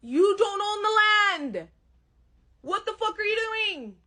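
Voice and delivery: angry yell. Acted. Comic yelling at an imagined Albertan squatter, angry. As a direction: scold the intruder.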